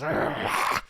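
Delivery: rough, wordless roar of frustration; mock hangry monster snarl; raging growl. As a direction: growl instead of answering. Man answers with wordless rage.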